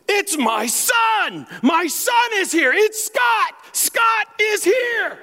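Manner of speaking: high, drawn-out shout of joy; ecstatic announcing. Retold or imitated. Father announcing his son to other patients, shouting. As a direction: shout it slowly to the whole room, voice way up high. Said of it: "announcing to everybody who didn't care".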